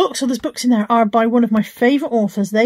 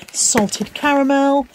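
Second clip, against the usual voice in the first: normal talking voice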